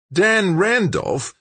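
slow, very high incredulous outburst; blurted disbelief. A narrator's reading. Big George stunned by the stranger's name, blurting it. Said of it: "blurted Big George".

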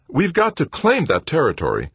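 high, loud and eager; keen, emphatic lift in the voice; brightening. A narrator's reading. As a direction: brighten up, full of purpose. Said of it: "his tone brightening immediately".

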